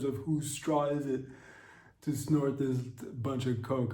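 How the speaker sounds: put-on slow, high doped-up teen; dreamy kid bragging to mom; high teen son. Son tells mom about school.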